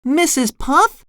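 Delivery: high, baffled cartoon voice; SpongeBob crying out. A narrator's reading. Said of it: "he cried".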